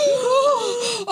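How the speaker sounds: sharp high gasp of fright; startled gasp. Real, not acted. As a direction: gasp in real surprise. A moth suddenly dives at her.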